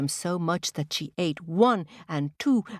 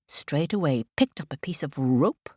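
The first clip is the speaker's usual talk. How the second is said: plain reading voice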